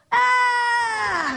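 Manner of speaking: high, loud astonished scream; crowd's shocked scream. Retold or imitated. Bystanders see the woman sit up, astonished scream. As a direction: shriek as the dead sits up. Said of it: "Everybody goes".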